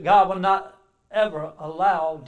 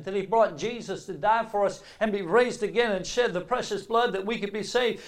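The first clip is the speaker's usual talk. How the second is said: normal talking voice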